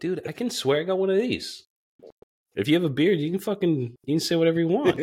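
high, emphatic comic justification; mock-defensive dad. Dad to his four-year-old, defensive high-pitched retort. Dad answers son's scolding, retold.

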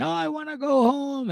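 high-pitched mock wail; mock crying. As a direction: whine it out as an imitation of crying. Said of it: "She's crying".

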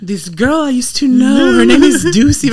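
high, very slow and soft mocking drawl; slow catty quote. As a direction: draw it out slowly, sweetly catty. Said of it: "היא פשוט עושה את האיפור שלה וכזה".

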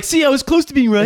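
high, loud, fast put-on voice; mock smug self. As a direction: gloat in a silly high voice. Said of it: "just so I could try to be like".

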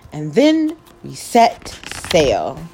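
ordinary delivery

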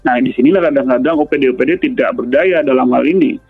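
usual speaking voice

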